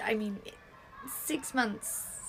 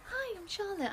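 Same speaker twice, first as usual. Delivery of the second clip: ordinary talk, nothing marked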